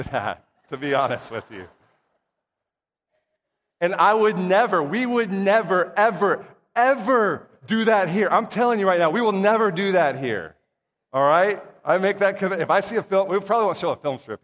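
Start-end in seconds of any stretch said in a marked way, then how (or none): 3.8-10.6 "never" and "ever" punched, louder and higher; emphatic vow. Real, not acted. Preacher promising the church they would never do that, emphatic. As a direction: hit "never, ever, ever" hard, raised voice.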